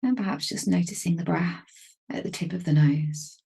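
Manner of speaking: calm, unhurried meditation voice with long pauses; even, measured delivery, leaving space to breathe; guided meditation. Real, not acted. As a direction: gently guide them into stillness, phrase by phrase.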